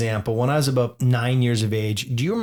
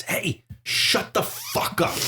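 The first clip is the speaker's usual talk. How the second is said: slow, strained hushed yell; hissed, drawn-out scolding at a dog; aggressive owner; 5 semitones higher, much slower than usual. Retold or imitated. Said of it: "That's a little aggressive".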